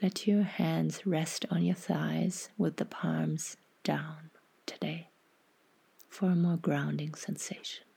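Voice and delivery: half-whispered, unhurried guidance; guided meditation. Acted.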